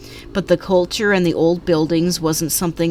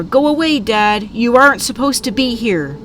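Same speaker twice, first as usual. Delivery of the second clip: higher, louder yell through a door; narrated yelling; 6 semitones higher, 4 dB louder than usual. A narrator's reading. Girl to her dad outside the door, yelling. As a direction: yell at someone to leave, without a full scream. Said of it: "She kept yelling at the door".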